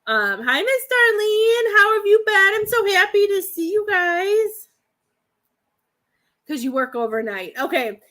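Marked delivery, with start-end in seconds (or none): 0.6-4.7 high, loud, excited hello; gushing, high-pitched welcome; delighted greeting. Real, not acted. Greeting a newly arrived viewer warmly.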